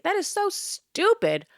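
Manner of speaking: high, slow scornful verdict. Real, not acted. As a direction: spit out the contempt. Ranting contempt.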